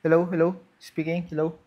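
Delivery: ordinary talk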